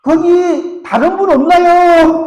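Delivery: put-on young man calling past God for another helper; shouting. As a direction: yell up for someone else. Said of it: "다시 이렇게 소리칩니다".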